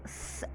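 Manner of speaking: स- as a long whistled hiss through joined teeth. Shown as a demonstration. Teacher showing the whistling seen sound. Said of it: "ऐसी आवाज़ तब आती है".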